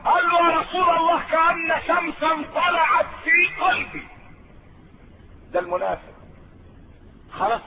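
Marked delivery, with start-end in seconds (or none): 0.5-4.1 higher, louder, slowed ecstatic declaration; joyful outcry. Acted. A new convert tells the Prophet, joyful and raised. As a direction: cry out the moment faith lit his heart.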